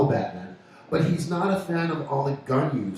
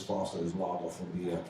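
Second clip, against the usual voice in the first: low, soft mock-Aussie slogan; Australian accent; 7 semitones lower, 10 dB quieter than usual. Host repeating a beer slogan, low mock-Australian.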